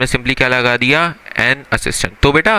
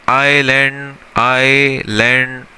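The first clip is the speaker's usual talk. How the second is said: stretched slow demo of island; slow model word; 2 semitones lower, much slower than usual. Shown as a demonstration. Teacher stretching island slowly for students. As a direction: say island slowly, drawing out each sound. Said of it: "अब भाई island word है".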